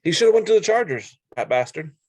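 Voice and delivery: half-joking indignant burst; mock outrage. Real, not acted. Sports grudge outburst.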